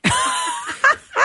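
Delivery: high burst of laughter; real laugh at the text. Real, not acted. Host laughing at a listener's text. Hearing Home People.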